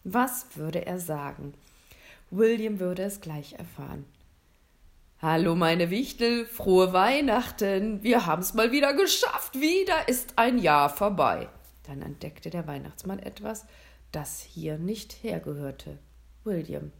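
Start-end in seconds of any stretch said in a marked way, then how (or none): 5.2-11.5 big loud Santa voice, climbing in pitch; booming festive announcement; jolly Santa, triumphant greeting. A narrator's reading. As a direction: hail the whole crowd of elves heartily. Santa greets his elves.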